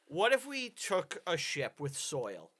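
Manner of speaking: ordinary talk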